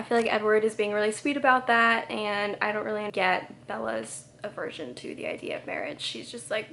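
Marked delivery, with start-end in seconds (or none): none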